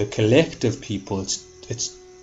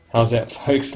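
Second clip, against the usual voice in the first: usual speaking voice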